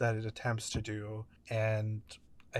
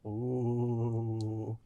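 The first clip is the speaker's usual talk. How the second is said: low haunting voice; 4 dB quieter than usual. Shown as a demonstration. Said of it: "that's how you should start this film".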